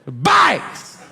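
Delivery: sudden high, explosive shout; hammed-up prisoner's bellow; yell. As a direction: yell it back at the kid in disbelief. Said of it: "that's why I yell".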